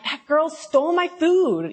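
high, drawn-out mock outrage; hammed-up indignant accusation, slow and high; mock accusing. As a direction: accuse like a wronged little sister. Acts out her own accusation.

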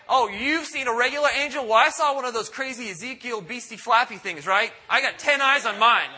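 mock bragging. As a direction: show off like a smug know-it-all. Mocking bragging believers about angels.

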